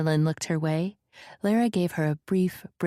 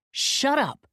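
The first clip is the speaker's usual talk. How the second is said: sharp, much higher outburst; shouted; 9 semitones higher than usual. A narrator's reading. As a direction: cut him off with a shout. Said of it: "he shouted".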